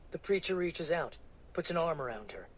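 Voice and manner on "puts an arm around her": ordinary delivery, nothing marked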